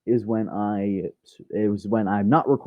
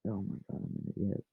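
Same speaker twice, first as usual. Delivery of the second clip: ordinary delivery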